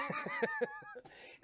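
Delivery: very high cackle; real laughter. Real, not acted. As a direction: crack up. Laughs at the death.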